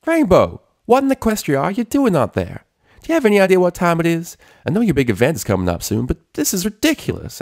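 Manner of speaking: high, loud country scolding, quick toward the end; scolding farm pony. A narrator's reading. Applejack scolding Rainbow for oversleeping, loud and high. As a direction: scold her like a bossy friend.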